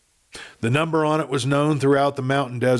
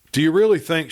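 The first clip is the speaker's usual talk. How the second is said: blurted, raised and louder; bursting out; 5 semitones higher, 5 dB louder, faster than usual. A narrator's reading. Kate can't bear the silence any longer, blurting raised and louder. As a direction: let it burst out after holding it in. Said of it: "she burst out".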